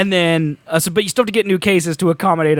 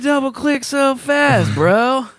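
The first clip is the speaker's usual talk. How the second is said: stoner; 4 semitones higher, slower than usual. Pretend. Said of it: "Great stoner impression".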